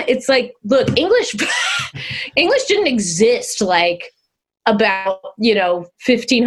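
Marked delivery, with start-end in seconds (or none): none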